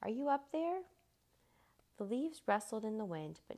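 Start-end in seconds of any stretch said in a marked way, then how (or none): 0.0-0.8 high, loud questioning call; raised voice, lifting at the end. A narrator's reading. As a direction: call out to someone far overhead. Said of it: "he called".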